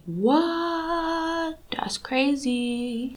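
drawn-out, hushed stunned reaction; mock amazement. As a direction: stretch the words out softly, mind blown. Acts out reaction to discovery.

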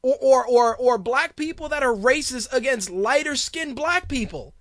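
ordinary delivery

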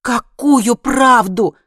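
high, loud, drawn-out cry; shouting back. A narrator's reading. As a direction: scream the question back, stretching it. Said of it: "тоже закричала она". (отчаянный протяжный крик)

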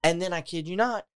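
normal talking voice

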